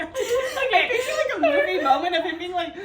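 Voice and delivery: barely getting it out through the giggles; laughing hard. Real, not acted. Cracking up retelling.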